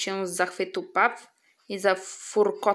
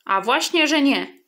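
proud retort; 5 semitones higher, 5 dB louder than usual. Acted. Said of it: "zaterkotał dumnie Perkos".

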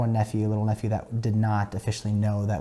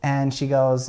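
ordinary talk

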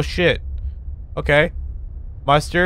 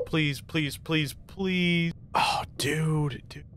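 much softer, pleading under the breath; hushed pleading; 3 semitones higher, 12 dB quieter, much slower than usual. Real, not acted. Hiding player begging the monster not to find him, hushed. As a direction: beg quietly not to be found.

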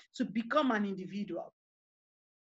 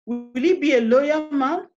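forceful questions; 2 semitones higher, 6 dB louder than usual. Real, not acted. Rhetorical tombstone questions.

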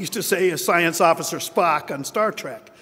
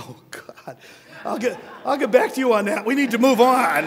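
flustered dodge; 6 semitones higher than usual. Retold or imitated. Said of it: "And you go".

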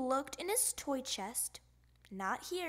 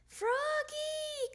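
calling out. Acted. Mother calling across the house.

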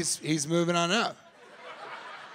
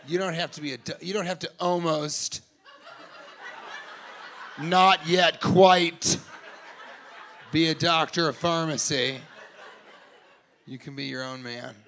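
soft, lower comforting tone; lightly voiced mom reassuring son; mock tender mom; 5 semitones lower, 4 dB quieter than usual. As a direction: soothe him like a mother.

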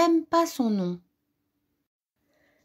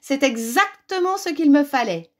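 high, thrilled storybook witch; gushing, pitched-up witch voice; exclaiming witch; 7 semitones higher, 4 dB louder than usual. A narrator's reading. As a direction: exclaim with greedy delight as the witch. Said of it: "s'écria la sorcière".

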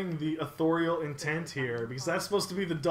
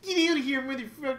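put-on kid voice; 7 semitones higher, faster than usual. Continues the kid voice.

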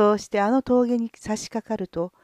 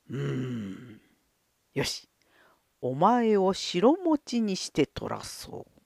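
low lordly voice; 6 semitones lower, 4 dB quieter, slower than usual. Acted. Lord considers and decides.

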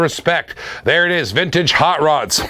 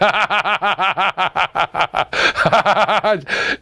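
long laugh; 3 semitones higher than usual. Real, not acted. Laughing at own fast-internet joke.

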